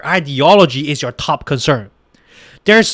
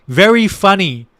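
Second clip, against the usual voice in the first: ordinary delivery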